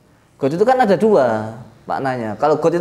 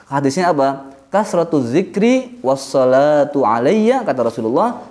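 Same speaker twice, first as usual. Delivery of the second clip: normal talking voice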